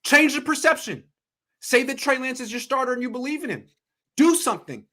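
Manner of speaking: high, loud demands; worked-up sports host shouting; exasperated yelling. Real, not acted. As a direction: shout orders at the front office. Yelling advice at the team.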